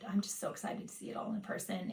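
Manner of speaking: soft, breathy, secretive whisper of glee; a whispered thrill; excited whisper. Real, not acted. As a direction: hush it like a delicious secret. Excited hushed confession.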